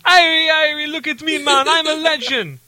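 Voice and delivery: high mock Jamaican voice; Jamaican accent. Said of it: "my Jamaican accent".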